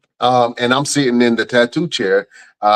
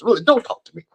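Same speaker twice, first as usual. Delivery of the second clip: high, exasperated don't talk to me; mock offended, high and laughing; indignant retort; 10 semitones higher than usual. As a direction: snap back with a laugh, pitched up. Annoyed retort to cop.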